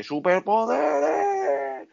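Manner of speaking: high, very slow, stretched-out cry; mock super-power cry. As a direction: drag it out like a crazed heroine announcing her powers. Voicing the drugged girl.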